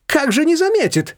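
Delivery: high-pitched exclamation with a hint of a chuckle; amused, scoffing. A narrator's reading. Shushana mocking her friend's worry, amused and scoffing. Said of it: "хохотнула Шушана".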